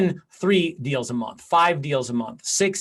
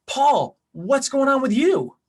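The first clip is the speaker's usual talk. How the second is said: higher, light voiced Andrew; friend asking back; 8 semitones higher, slower than usual. Friend turning the call back to the agent, lightly voiced. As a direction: toss the question back warmly.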